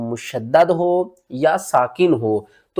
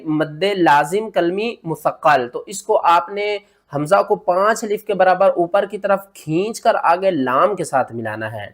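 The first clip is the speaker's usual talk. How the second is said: ordinary delivery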